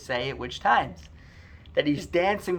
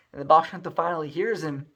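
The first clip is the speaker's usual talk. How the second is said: plain reading voice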